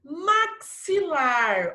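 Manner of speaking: "Maxilar" very slow, with a marked ks. Shown as a demonstration. Teacher pointing pupils to the little sound, "Maxilar" with clear ks. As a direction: stretch "Maxilar" into mak-si-lar. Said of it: "Ó o sonzinho".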